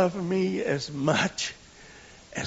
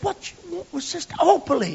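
high, questioning lady's voice; woman whispering back at the boy; church lady asking; 13 semitones higher than usual. Retold or imitated. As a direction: ask like a surprised older woman. Opal asks the boy.

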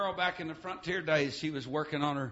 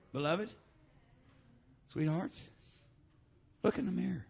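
tender; 3 semitones higher, 4 dB quieter than usual. Acted. Tender appeal after shout.

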